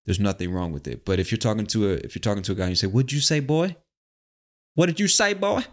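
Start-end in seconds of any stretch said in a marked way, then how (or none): 2.9-3.8 higher-pitched mock Southern challenge. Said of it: "like, a stereotypical Southern accent". 4.7-5.6 very high-pitched, hammed-up Southern accent; bad Southern accent. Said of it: "I can't do a Southern accent very good".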